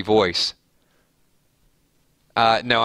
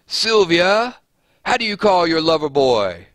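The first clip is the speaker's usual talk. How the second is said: high put-on voice for a Dirty Dancing line; movie line voice; 6 semitones higher, 4 dB louder than usual. Streamer quoting Dirty Dancing to chat, high playful put-on voice. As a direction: quote the film in a lifted, lightly sung voice. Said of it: "Isn't that like a line from Dirty Dance?".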